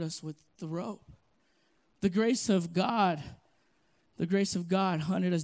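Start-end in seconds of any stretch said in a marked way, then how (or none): none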